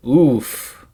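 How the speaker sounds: loud wincing oof; pained oof. Real, not acted. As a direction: grunt of sympathetic pain. Reacting to a bad buy.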